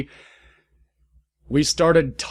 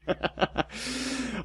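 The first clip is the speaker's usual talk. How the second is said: breathy laugh; 11 semitones higher, 10 dB quieter than usual. Real, not acted.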